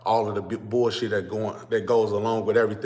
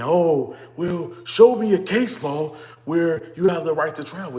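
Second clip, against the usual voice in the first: high, loud sneering mimic of a doubter; mock the know-it-all troll; 7 semitones higher, 4 dB louder, slower than usual. Streamer mocking trolls in comments, high and loud sneer. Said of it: "I see a lot of trolls on this keep saying".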